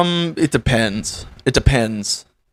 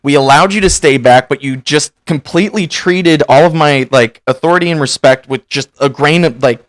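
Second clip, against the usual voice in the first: loud, high dressing-down of a scoutmaster; a hint of the scoutmaster's rage, loud and steady; livid scolding; 4 semitones higher, 6 dB louder than usual. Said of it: "and just so livid".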